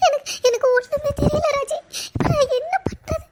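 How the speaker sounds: high, sobbing, stammering plea; crying. Acted. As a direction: protest helplessly through sobs. Accused girl breaks down crying.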